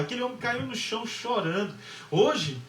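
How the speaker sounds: ordinary delivery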